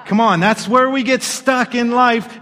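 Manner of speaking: usual speaking voice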